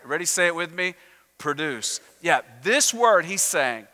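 ordinary delivery

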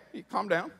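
usual speaking voice

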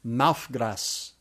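Maufgras said as MAUF-gras with a falling end; Maufgras, one word, stress on MAUF; pidgin demo. Shown as a demonstration. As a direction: sound out Maufgras slowly. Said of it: "Here's one a little harder".

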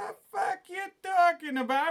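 indignant retort. Acted. Pizza argument.

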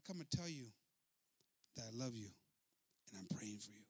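soft, low, unhurried reply; gentle sincerity. Acted. Widow answering the yelling chief, gentle sincerity. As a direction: let it sink softly, meaning every word.

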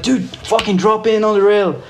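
high-pitched retold hype from a buddy; imitating a fired-up friend; fired-up urging. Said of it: "so fired up".

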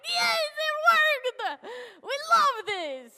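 "Yes, it worked!" cried out high, loud and slow; a joyful yell of victory; loud, high mock cheer with Vladimir. TV finally works, cheering.

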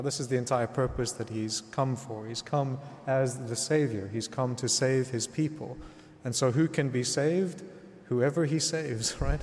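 ordinary talk, nothing marked

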